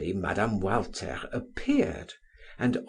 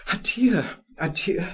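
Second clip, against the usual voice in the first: higher, louder farewell cry; cried out; 7 semitones higher, 4 dB louder than usual. A narrator's reading. Rejected suitor crying farewell to the lady: higher, louder cry. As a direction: fling the goodbye as he flees.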